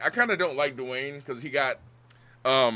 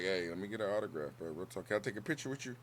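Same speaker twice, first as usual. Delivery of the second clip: much softer, starstruck ask; hushed fan request; 6 semitones lower, 11 dB quieter, faster than usual. Retold or imitated. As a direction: ask shyly for an autograph.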